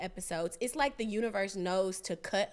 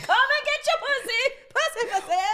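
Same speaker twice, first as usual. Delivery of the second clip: high, loud hawker's cry, laughing; street vendor call; 14 semitones higher, 8 dB louder than usual. Pretend. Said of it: "I'd probably sell my pussy with a bell just on the street".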